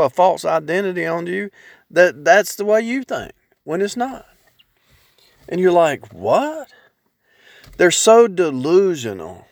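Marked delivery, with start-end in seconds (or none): 6.1-6.7 incredulous what. Acted. Acting out disbelief at society.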